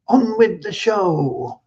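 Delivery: high, drawn-out singsong announcement; showman's rallying call. Retold or imitated. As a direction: imitate a cinema crowd's cry, stretching the words. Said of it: "at the end of the sing-song, they'd say".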